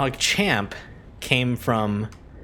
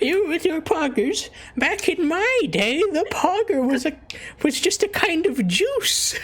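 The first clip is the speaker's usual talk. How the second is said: put-on old lady voice. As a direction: reminisce like a cranky old lady. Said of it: "like, an old lady".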